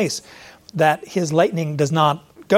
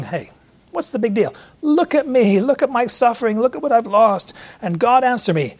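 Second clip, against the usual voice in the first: Job complaining; 6 semitones higher than usual. Retold or imitated. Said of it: "Job's over there saying".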